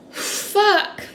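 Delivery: high curse breaking into a sob; crying curse. Real, not acted. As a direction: cry it out. Breaking down mid-story.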